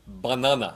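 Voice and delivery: "Banana" with open "ah" vowels, ba-NAH-na; Portuguese pronunciation. Shown as a demonstration. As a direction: say "Banana" the Portuguese way, middle A as in "father". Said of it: "the Portuguese word for banana".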